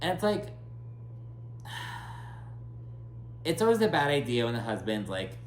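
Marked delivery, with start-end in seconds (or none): 0.5-3.4 long, soft exhale; long sigh. Real, not acted. Host reacting to husbands stepping in, long soft sigh. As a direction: let out a drawn-out, weary sigh.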